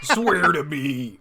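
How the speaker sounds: slow gravelly Batman growl. Acted. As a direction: drag it out like the Dark Knight. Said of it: "two favorite Batman quotes".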